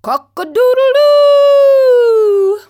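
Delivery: long, very high, loud rooster call; rooster crow. A narrator's reading. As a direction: crow it out like a proud cockerel. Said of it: "he called out to his hens".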